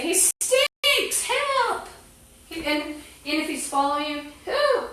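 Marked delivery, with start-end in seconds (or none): 0.0-1.9 high mock cry. Shown as a demonstration. 4.5-4.9 squealed ooh. Shown as a demonstration. Mimes disgust at a follower.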